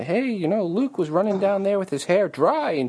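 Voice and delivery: high-pitched fussy correction; nitpicky detail geek. Retold or imitated. Listener voicing herself catching a continuity error, high nitpicky. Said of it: "I'm the person that would say".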